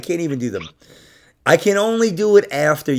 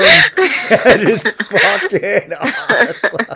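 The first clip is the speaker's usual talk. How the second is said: delighted laughing; 9 semitones higher, 7 dB louder, much slower than usual. Real, not acted. Delighted by the prank number.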